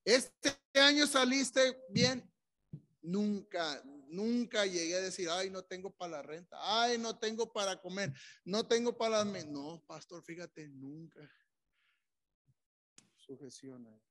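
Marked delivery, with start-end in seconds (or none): 0.0-9.4 loud, high-pitched sermon delivery; raised, emphatic pulpit voice; full-force preaching. Acted. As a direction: preach it out to the whole congregation.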